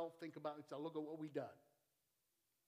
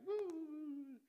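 normal talking voice

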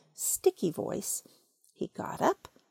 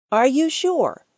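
a touch higher, louder puzzled question; more surprised; 4 semitones higher, 8 dB louder than usual. Acted. Rabbit asks Pooh in mild surprise. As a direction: ask as if you can't believe it. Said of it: "still more surprised".

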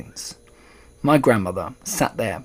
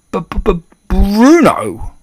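high, loud, stuttered cry; stutter, then burst out with the name; shocked stammer; 8 semitones higher, 10 dB louder, much slower than usual. A narrator's reading. Father aghast at his mouse son, stammering. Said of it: "stammered Mister Jenkins again".

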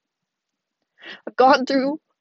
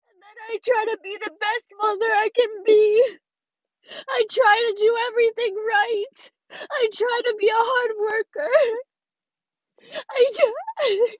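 high, tearful insistence; real crying; 5 semitones higher than usual. Real, not acted. Young mother defending how hard she tries, crying. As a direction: cry while pleading that you try. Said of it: "I sound like a fucking dumbass when I'm crying".

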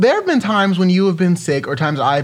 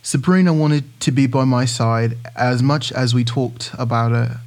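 British accent; 4 semitones lower than usual. Shown as a demonstration. Co-host reading Idris Elba's quote on request, British accent. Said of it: "Can you read that in your British accent?".

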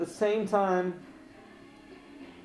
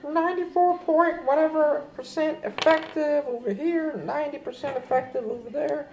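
much higher mock boasting voice, parroting the drug companies; 12 semitones higher than usual. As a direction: squeak out the claims like a bragging salesman. Mocking vaccine makers' boasts.

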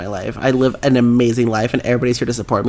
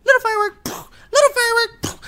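taunting singsong. Retold or imitated. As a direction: taunt her like a child while smashing. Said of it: "She's like".

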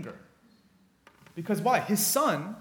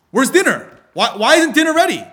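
retold furious yell, much higher and louder; angry dad shouting; 10 semitones higher, 14 dB louder than usual. Father storming in after work, yelling for dinner. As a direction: yell it like a father who wants dinner now.

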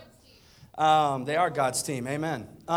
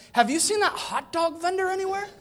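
lightly voiced eager kid, higher; a hint of the son; 13 semitones higher, 5 dB louder than usual. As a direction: play the boy asking innocently. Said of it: "He's like".

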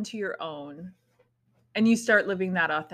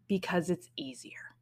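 whisper; 3 semitones lower, 7 dB quieter than usual. Real, not acted. Confession, dropping to a whisper.

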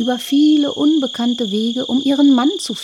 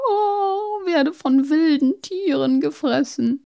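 tearful princess; 7 semitones higher, 5 dB louder than usual. Acted. Princess weeps lost in forest.

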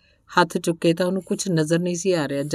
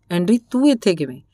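ordinary delivery, nothing marked

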